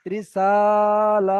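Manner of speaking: রিসালাতি with সা and লা held equally long; loud sustained সা and লা in রিসালাতি, short রি and তি; two equal elongations. Shown as a demonstration. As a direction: stretch the two middle syllables of রিসালাতি evenly. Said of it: "এই দুটো একই টান হবে".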